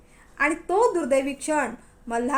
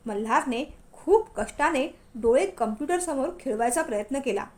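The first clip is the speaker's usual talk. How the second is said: ordinary delivery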